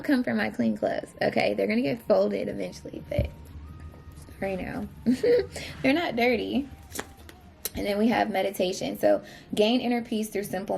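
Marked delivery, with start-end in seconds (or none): 4.9-5.8 short high giggle; laugh. Real, not acted. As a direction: burst into a giggle. Laughing at own messy laundry.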